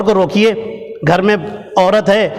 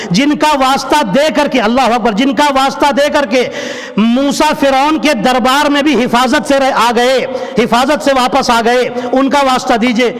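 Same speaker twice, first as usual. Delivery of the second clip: raised, rhythmic preaching refrain; fervent preaching; 4 semitones higher than usual. Acted. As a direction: drive the repeated phrase like a rising chant. (جوشیلا، بلند آواز میں وعظ)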